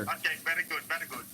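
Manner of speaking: hammed-up Indian accent; put-on Indian accent. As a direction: pitch a dodgy deal in an Indian accent. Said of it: "Oh, he's doing an Indian".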